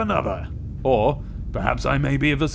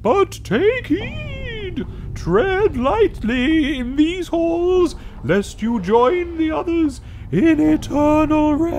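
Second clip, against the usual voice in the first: high, very slow ominous wail; fluty ghost warning; 14 semitones higher, 3 dB louder, much slower than usual. Acted. Ghost's parting threat to the player, slow high fluty wail. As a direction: stretch the warning out eerily. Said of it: "Nice little fluty end".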